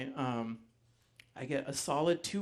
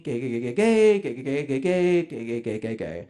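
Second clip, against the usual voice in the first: mocked harping; 5 dB louder than usual. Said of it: "she's still harping at me".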